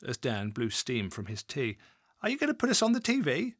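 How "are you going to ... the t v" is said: eager question. A narrator's reading. The mother asks eagerly.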